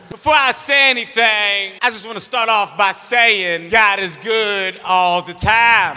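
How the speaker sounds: slow, drawn-out speechmaking; cousin's solemn speech. Acted. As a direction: draw each phrase out like a podium testimony. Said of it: "the speech that my cousin gave".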